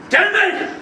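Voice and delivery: ordinary delivery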